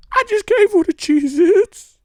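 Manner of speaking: very high, loud, slow put-on meek voice; hammed-up timid excuse; mock servile voice. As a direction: squeak it out like a caught servant.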